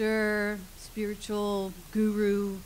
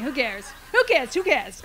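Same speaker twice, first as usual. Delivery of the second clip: laughing dismissal; 6 dB louder than usual. Real, not acted. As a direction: laugh it off loudly. Host laughs off her own aside.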